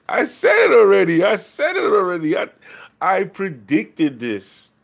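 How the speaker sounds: high, loud crowing; real excited outburst, pitch way up; vindicated shouting. Real, not acted. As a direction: shout it out, thrilled to be proven right. Crowing that they predicted it.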